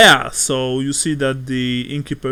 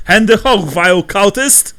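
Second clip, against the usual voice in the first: loud, high German guard bellow; screaming at the top of his lungs; 6 semitones higher, 5 dB louder than usual. Acted. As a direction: roar it at the stranger with full lungs. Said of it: "he screams at the top of his lungs".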